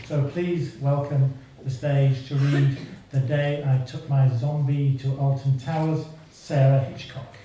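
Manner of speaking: ordinary delivery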